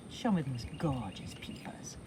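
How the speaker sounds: fast soft whisper. Real, not acted. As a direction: whisper it quickly to the cat. Whispering to the cat.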